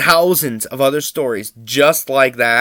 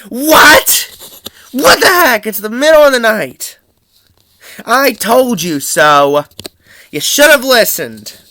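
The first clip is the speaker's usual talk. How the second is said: retold mom's outraged scolding; 6 semitones higher, 5 dB louder than usual. Mom scolds son on phone.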